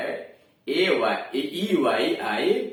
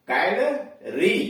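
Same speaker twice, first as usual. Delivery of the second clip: Calorie slowly split, with a gap before री; syllable by syllable; 4 semitones higher, much slower than usual. Shown as a demonstration. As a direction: break calorie into कैल, then री. Word then broken into syllables. (Calorie को धीरे-धीरे तोड़कर बोलें)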